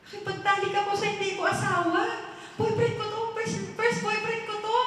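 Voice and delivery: high, flustered disbelief; acted alarm. Sinner finding an intimate secret on file, alarmed. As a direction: read it out in rising alarm.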